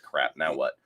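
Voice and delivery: ordinary delivery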